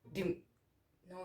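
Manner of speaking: normal talking voice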